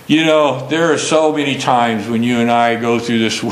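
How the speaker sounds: ordinary delivery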